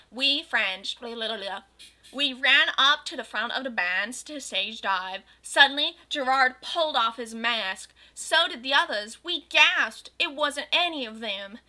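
plain reading voice

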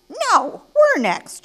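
high, loud shout; indignant storybook shout. Acted. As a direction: shout over the others to claim the turn. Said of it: "shouted the flamingos".

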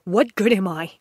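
upset partner lashing out at himself, loud and bitter; bitter outburst. Acted. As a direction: burst out against your own worth.

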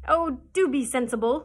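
loud scolding duck; exasperated cry. A narrator's reading. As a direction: scold the dreamy doctor loudly. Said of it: "cried Dab-Dab".

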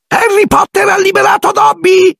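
piercingly high, joyful elf shriek; Dobby, shrieking. A narrator's reading. Elf looks up at his liberator Harry, shrieking. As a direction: squeal it out at the top of your pitch. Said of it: "strillava l'elfo".

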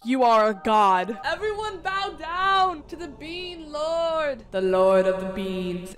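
loud, slow, mock-solemn proclamation; a hammed-up royal announcement; grand proclaimer. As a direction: herald the Bean Lord to the crowd. Hails beans hoarder as Bean Lord.

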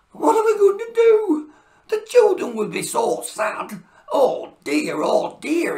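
high, loud fretful wail; worried. Acted. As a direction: panic over the lost presents. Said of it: "he said worriedly".

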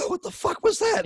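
high, shocked outburst after gasps; gasping for air. Retold or imitated. Joel let out from under the blanket, gasping. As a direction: act out coming up from being smothered.

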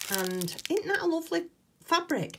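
thrilled high gush to viewers; delighted squeal. Real, not acted. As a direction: squeal it like you love the present. Delighted at fabric swap gift.

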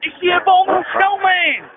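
high, mock-alarmed shout. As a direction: cry it out like spotting a monster, hammed-up. Jokingly spotting a hairy man.

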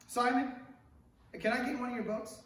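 ordinary talk

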